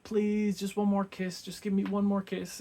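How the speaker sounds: high, loud mock begging; hammed-up pleading. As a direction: overplay a whiny plea.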